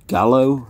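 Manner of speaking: "Gallo" rhyming with "shallow"; short A, L sounded. Shown as a demonstration. Vlogger guessing at an editor's surname, "Gallo" with a short A. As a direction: say "Gallo" with the A of "cat". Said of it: "however you say his name".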